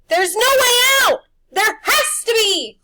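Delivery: high, loud and slow, desperate; panicked cry. A narrator's reading. Boys trapped in the mist with the beast near, panicked.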